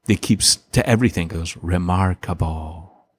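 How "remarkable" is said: slow, drawn-out catchphrase; lightly voiced kid stretching every syllable; imitating TV kid. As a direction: draw it out like a child's stock reply. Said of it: "there's this one kid".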